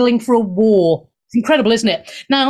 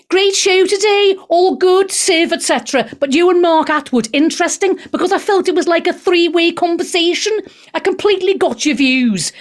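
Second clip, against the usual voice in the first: higher-pitched put-on Scouse; Scouse accent; 4 semitones higher than usual. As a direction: read it out in a wobbly Scouse accent. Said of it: "my scouse has gone all over".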